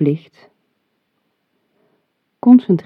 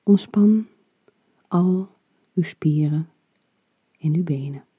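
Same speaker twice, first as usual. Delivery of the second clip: right in your ear. Acted. Whispered relax cue.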